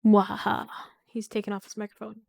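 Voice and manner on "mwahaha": loud villain cackle; evil laugh. Pretend. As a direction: mwahaha like a mad scientist.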